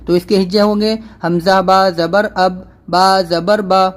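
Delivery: ordinary talk, nothing marked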